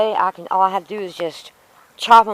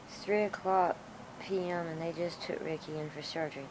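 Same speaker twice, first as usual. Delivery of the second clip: normal talking voice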